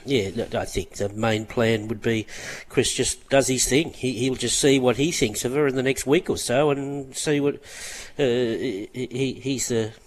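normal talking voice

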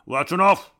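loud commanding coach bellow; booming shout. Retold or imitated. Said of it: "is like".

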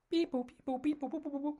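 high beep boop noises; phone dial beeps. Acted. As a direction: mimic keypad tones. Dialing phone with mouth sounds.

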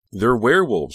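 loud, high blurted cry; bursting out. A narrator's reading. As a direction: blurt it out at full volume. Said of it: "she burst out".

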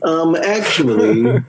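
high, drawn-out nerd correction, laughing; mock comic book nerd. Host teased as a comic book nerd, replying in nerd voice. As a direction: play the pedantic nerd, high and slow. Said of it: "Comic book nerd".

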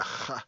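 "ha-" as a throaty kh scraped at the back of the mouth; Arabic-style guttural. Shown as a demonstration. Guest shows how Khabib's name starts, "ha-" as guttural kh. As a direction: make "ha-" an Arabic kh. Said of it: "almost like a Arabic".

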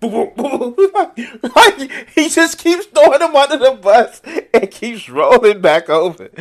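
laughing through the words; can barely get it out for laughing. Real, not acted. Streamer laughing at Gaetz clip while talking.

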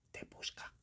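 hushed, suspenseful whisper. Acted. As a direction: whisper it like a secret threat. Story ends in suspense.

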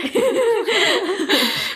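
high-pitched giggling fit; laughter. Real, not acted. Co-host cracking up at snack cart rant, high laughter.

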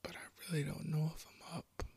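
soft, breathy uncertainty; halting, half-whispered confusion; disoriented hush. Acted. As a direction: say it quietly, lost between dream and waking. Disoriented, unsure if awake.

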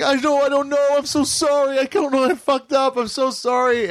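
high, wailing mock sobby apology; imitating a crying kid begging forgiveness; tearful apologizing. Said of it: "The kid starts crying".

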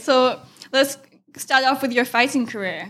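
ordinary talk

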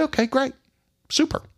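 sarcastic sing-song okay, pitched high; bright fake agreement, voice jumping up; mock cheerful. Sarcastic reply to the deputies.

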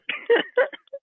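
short high delighted cackle; high laughing. Real, not acted. Caller laughs at victim.

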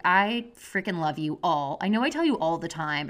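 ordinary delivery

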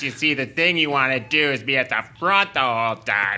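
lightly voiced know-it-all amateur racer; Cat three voice. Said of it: "that's my Cat three voice".

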